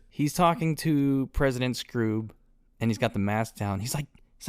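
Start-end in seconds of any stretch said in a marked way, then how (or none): none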